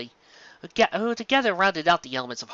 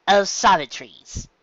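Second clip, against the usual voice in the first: translating title; 4 semitones higher, 6 dB louder, slower than usual. A narrator's reading. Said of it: "Trixie translated".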